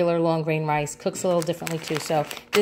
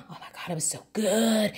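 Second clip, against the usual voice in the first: hushed, swooning over food; swooning delight; 3 semitones higher, 5 dB quieter than usual. Real, not acted. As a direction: relish it softly, drawing out "good". Swooning over remembered chili.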